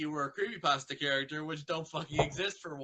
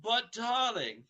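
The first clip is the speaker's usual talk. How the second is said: Rarity; 6 semitones higher, slower than usual. Acted.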